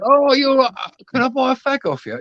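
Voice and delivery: high-pitched mock-matey pestering; overfamiliar cadger. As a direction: imitate a chummy stranger on the scrounge. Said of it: "like as if they've known you for years".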